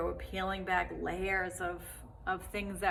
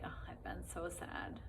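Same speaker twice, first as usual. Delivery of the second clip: very soft, near-whispered sad sigh; 12 dB quieter than usual. Acted. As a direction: say it softly, like a downcast self-pitying murmur. Voicing a sad self-remark.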